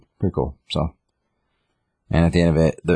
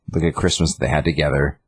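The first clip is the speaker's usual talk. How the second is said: normal talking voice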